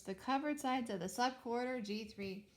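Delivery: usual speaking voice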